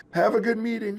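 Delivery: high, called out toward the phone; shouted goodbye. A narrator's reading. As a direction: call it across the room to the phone. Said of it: "shouted the cubs".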